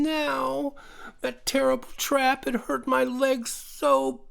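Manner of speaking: high creature voice, slow and lost; a little animal fretting about getting home; high-pitched frightened woodland creature; despairing creature. A narrator's reading. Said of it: "Teddy continued".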